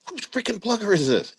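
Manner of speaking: high-pitched exasperated question; retold angry demand. Commander confronting his staff over lost equipment, irate. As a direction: re-enact demanding an answer, pitch way up.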